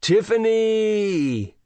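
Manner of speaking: high, drawn-out sing-song call; slow, high, triumphant call-out; grand announcement. A narrator's reading. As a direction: stretch it out like a game show winner. Teacher calls out top level grandly.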